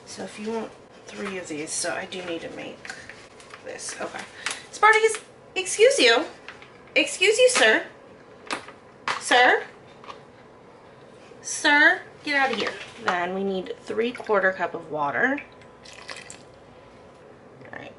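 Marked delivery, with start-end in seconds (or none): 4.7-12.7 talking to the dog. Real, not acted. Scolding the dog playfully.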